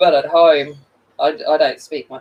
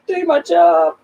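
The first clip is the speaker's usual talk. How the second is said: mock whiny excuse; 7 semitones higher than usual. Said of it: "I'm like".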